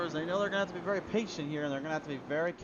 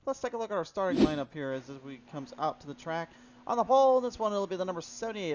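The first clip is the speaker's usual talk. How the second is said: ordinary delivery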